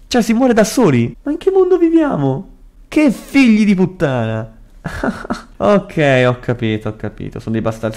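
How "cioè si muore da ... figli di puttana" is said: lamento acuto e incredulo; indignazione. Real, not acted. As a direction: sfogati a voce alta di tono contro il gioco. Protesta contro il gioco. (indignato, voce acuta)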